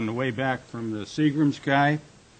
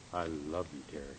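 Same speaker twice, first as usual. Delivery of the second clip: much softer and lower, intimate; tender declaration; 7 semitones lower, 13 dB quieter, faster than usual. Acted. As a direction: say it close and quiet, only for her. Husband declaring love to wife.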